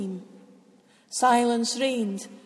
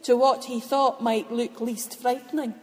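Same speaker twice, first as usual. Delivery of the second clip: ordinary delivery, nothing marked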